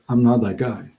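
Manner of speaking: ordinary delivery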